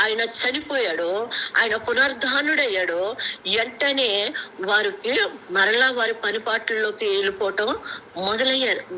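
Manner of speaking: usual speaking voice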